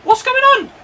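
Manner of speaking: high-pitched, exasperated yell; exasperated outcry. Real, not acted. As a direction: cry out in disbelief at your players. Players falling over in the match.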